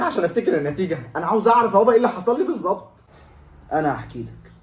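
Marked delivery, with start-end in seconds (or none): 1.2-2.9 loud, higher exasperated demand; frantic son shouting; pleading shout. Acted. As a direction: yell it in desperation.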